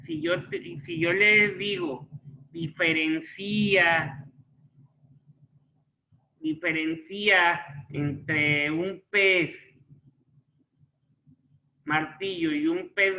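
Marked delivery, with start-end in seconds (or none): 2.5-9.5 "diferencia" slowly, as di-fe-ren-CÍ-a; stress on the í. Shown as a demonstration. Teacher demonstrating the accent on the I, slow "diferencia" stressed on CÍ. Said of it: "ahí estoy haciendo la referencia en la I".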